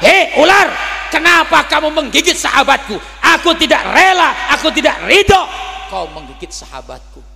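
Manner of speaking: menghardik tinggi dan keras; hardikan keras. Acted. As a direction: tegur ular dengan lantang. Nabi rebukes the snake. (Nabi menegur ular, suara tinggi dan keras)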